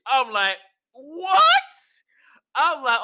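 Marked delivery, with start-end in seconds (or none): 0.9-1.4 high, loud incredulous yelp; retold astonishment. Retelling own shock at the footage, high and incredulous. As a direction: burst out in disbelief.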